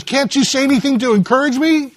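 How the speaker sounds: high-pitched acted plea; pleading wife. Discouraged wife begging her husband for encouragement, high plea.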